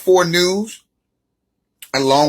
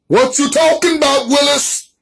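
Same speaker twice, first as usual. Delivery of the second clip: put-on pitched-up quote of the sitcom kid's line; playful higher-pitched sitcom kid voice; sitcom catchphrase impression; 7 semitones higher than usual.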